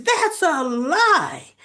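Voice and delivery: hammed-up slow denial; mock flat denial. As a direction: drag out the denial firmly. [name] denying Drew's claim.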